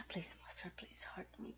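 ordinary delivery

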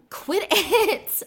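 high, loud yelp; startled shout. Acted. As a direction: squeal it, startled. Startled by tickling.

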